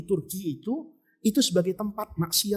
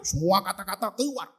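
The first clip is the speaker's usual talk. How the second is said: usual speaking voice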